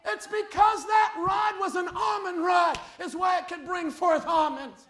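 loud, rising preacher's cry to the flock; fiery preaching. Acted. Pastor pleading with the congregation, loud fiery preaching. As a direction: preach it out, pushing hard on every phrase.

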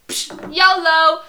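high, loud, drawn-out whoop; mock reckless abandon. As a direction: toss it off like a hammed-up daredevil. Voices heroine before cliff jump.

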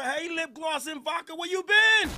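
loud shout across the room; calling out. Real, not acted. Streamer shouting to a viewer he missed, loud call. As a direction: call her out loud, happy to see her.

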